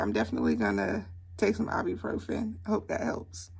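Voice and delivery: normal talking voice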